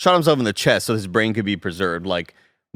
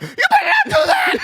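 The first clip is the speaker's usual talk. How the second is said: very high laughing shout, louder; shrieking laugh-shout; 5 dB louder than usual. Pretend. Teasing friend about the fight, shrieking laugh-shout. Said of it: "I was expecting more like".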